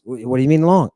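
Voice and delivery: ordinary delivery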